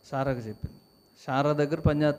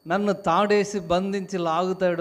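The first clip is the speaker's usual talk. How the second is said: higher, complaining kite voice; whiny complaint; 5 semitones higher, 5 dB louder than usual. Acted. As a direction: grumble at the string holder. Kite complaining about string. (గాలిపటం లాగా చిరాకుగా ఫిర్యాదు)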